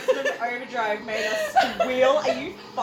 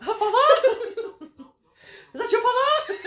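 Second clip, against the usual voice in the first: higher and louder, laughing through it; laughing mock voice; 10 semitones higher, 7 dB louder, faster than usual. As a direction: squeal it out while laughing. Mocking a character through laughter.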